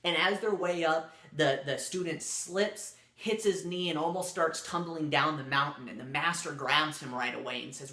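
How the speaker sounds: ordinary delivery, nothing marked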